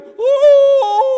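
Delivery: a high, loud, terrified Ooh of dread; terrified gasp. Acted. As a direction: gasp it out in panic like a cornered villain. Haman's terrified reaction.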